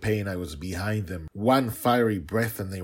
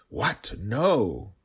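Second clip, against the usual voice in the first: sharp higher startled cry; alarmed protest; 4 semitones higher, 6 dB louder than usual. A narrator's reading. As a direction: object in sudden alarm. Dragon protests girl's plan.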